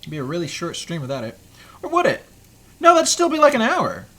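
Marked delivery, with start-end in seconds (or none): 1.8-4.1 higher and louder, playful; playful exclaim. Real, not acted. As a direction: pop it up playfully. Playful self-questioning.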